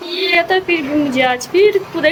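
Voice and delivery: a high, amused retelling to the viewers; high-pitched telling. Real, not acted. Comic buffalo mishap told high.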